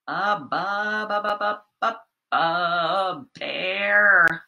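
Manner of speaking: halting ba- repeats landing on bear; playful suspense reveal; teasing build-up. Shown as a demonstration. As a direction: drag out the ba- to tease the kids. Guessing game, drawn-out syllables.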